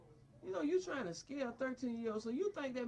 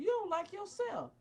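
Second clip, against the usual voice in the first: louder, higher furious retort; angry outburst; 8 semitones higher, 4 dB louder than usual. Real, not acted. Father shouting back at the psychiatrist in anger. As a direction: throw it back at him hard.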